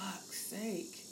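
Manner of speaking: exasperated mutter. Retold or imitated. Exasperated aside about mortality.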